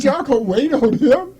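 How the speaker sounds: high, loud indignant question; incredulous protest. Retold or imitated. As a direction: challenge them, retelling your outrage. Retold protest at being passed over.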